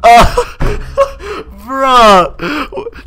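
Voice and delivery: high, squeaky helpless laughter; cracking up through the words; flabbergasted laughing. Real, not acted. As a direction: laugh in disbelief, voice shooting up high. Learns she was never fooled.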